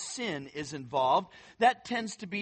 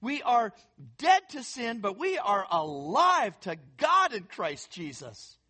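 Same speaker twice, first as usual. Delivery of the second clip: normal talking voice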